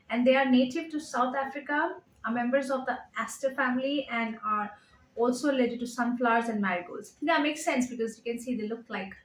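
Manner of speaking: ordinary delivery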